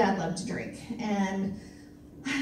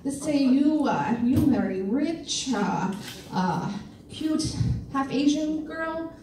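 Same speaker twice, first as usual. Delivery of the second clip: fake Chinese horoscope reading; 3 semitones higher than usual. Faking a horoscope for a cute boy, hammed-up slow translation. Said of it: "I would, I would fake it".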